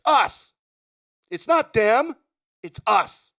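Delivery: worked-up movie rant, pitched high and loud; Tom Hanks rant. Acted. Neighbor ranting to Art about who the lunatics are, worked-up rant. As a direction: build to an outburst, punching the accusations. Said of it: "Good rant. Tom Hanks from The Burbs".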